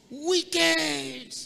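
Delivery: high, loud cry of the word, full force; preacher's shout. Real, not acted. Preacher to congregation, booming out the verse's last word.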